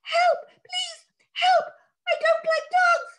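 tiny high crying creature voice; little voice. Acted. Hidden sprite crying for help, tiny and high. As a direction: squeak out a frightened plea. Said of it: "a little voice saying".

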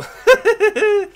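loud, high-pitched giggling cackle; gleeful loud cackling. Real, not acted. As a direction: burst into a shrieky laugh. Laughing at his kill.

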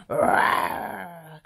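drawn-out rasping roar; playful dragon cry; mock dragon roar. A narrator's reading. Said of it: "cried Spud".

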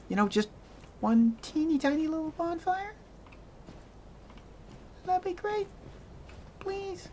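mock-cute begging for a bonfire; pleading squeak. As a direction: plead sweetly, fading to a tiny please.

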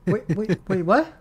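stunned, stopping mid-read; wait, what, in disbelief; surprised wait. Real, not acted. Stops reading in disbelief.

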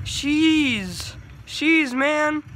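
high, drawn-out cheer; impressed exclamation. Real, not acted. Gamer hyped by his kills, high impressed exclamation.

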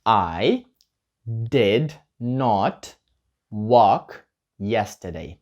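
slow, word-by-word full form; slow model answer. Shown as a demonstration. Teacher modelling the full negative slowly. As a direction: spell out each word for the class. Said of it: "Я не ходив вчора".

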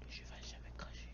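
fast hushed whisper. Real, not acted. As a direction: breathe it out under your breath. Whispered aside during game.

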